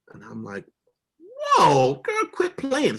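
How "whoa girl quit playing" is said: loud, high-pitched stop-that cry; shocked protest. Retold or imitated. Husband reacting to wife's fake call, shocked and high. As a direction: burst out high in alarmed disbelief.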